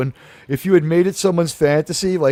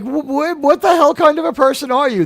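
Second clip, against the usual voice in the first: higher-pitched mock indignation; indignant retort; 7 semitones higher than usual. Lawyer confronting Landman at the crash site, indignant. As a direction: stammer into an indignant outburst. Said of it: "she's like".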